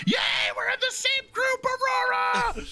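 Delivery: very high, gleeful shout; cheerful squeal. A narrator's reading. Student tackling her friend with a hug, overjoyed high squeal. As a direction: squeal it with delight, drawing out the name. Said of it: "breaking into a cheerful sprint".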